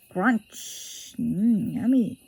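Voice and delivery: ordinary delivery, nothing marked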